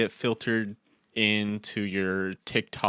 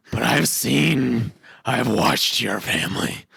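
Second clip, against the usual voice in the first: [name]; 2 semitones higher, 4 dB louder than usual. Acted. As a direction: intone it like an ancient, ominous creature. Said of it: "I just started doing the [name]".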